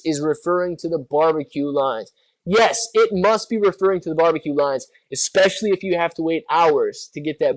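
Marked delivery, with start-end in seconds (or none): none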